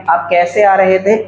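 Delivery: ordinary delivery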